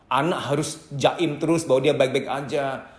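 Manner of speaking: ordinary talk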